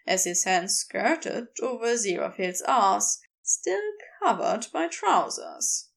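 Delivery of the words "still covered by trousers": ordinary delivery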